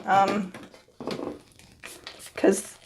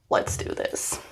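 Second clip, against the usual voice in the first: quiet self-pep talk; whispered resolve; 10 dB quieter, slower than usual. Real, not acted. About to trim, whispering encouragement. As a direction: whisper it to yourself. Psyching up before trimming.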